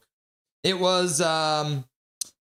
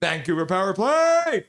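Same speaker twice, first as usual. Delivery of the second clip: announcer shout; 4 semitones higher, 5 dB louder than usual. Retold or imitated. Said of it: "Zach says".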